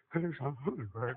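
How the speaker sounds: a mock stingy mumble; mumbled stingy offer. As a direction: mutter it like a cheapskate. Stingy giver imitated, mumbled.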